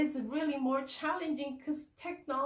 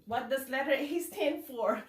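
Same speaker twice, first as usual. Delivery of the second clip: ordinary delivery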